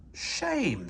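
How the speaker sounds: squeaky. Acted. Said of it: "squeaked Percy".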